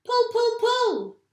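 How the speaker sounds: loud high frantic yells; yelling. A narrator's reading. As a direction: shriek it over and over. Mugglewump yelling on.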